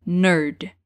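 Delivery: Nerd with a rounded English vowel, no final i. Shown as a demonstration. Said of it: "mind the pronunciation".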